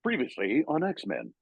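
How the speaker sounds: announcer voice. Shown as a demonstration. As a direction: demo the series intro voice, drawn out. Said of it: "when I do that voice".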